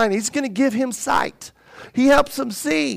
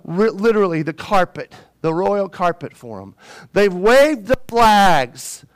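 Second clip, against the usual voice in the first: normal talking voice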